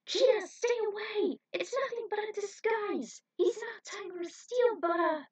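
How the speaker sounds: high, loud urgent warning; alarmed shout. A narrator's reading. Heroine warns Cheetah, shouting in alarm. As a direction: yell the warning to her friends.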